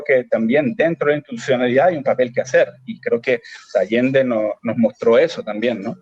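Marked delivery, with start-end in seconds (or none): none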